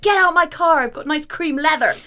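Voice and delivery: put-on a teenage boy shooing her out of his car. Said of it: "the boys would be like".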